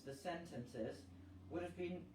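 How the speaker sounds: ordinary delivery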